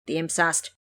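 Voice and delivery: usual speaking voice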